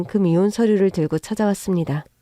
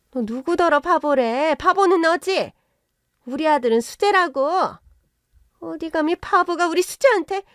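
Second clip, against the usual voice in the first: higher, sharp indignant retort; indignant snap; 8 semitones higher than usual. Acted. In-law mother flaring up at the daughter's insult, indignant. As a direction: bristle at the insult, voice shooting up. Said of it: "사위와 사부인이 동시에 발끈하더군요".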